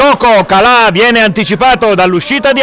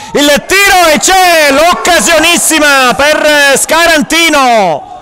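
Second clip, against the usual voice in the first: excited chance call; 6 semitones higher than usual. Real, not acted. Near miss chance.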